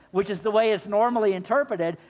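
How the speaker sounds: ordinary delivery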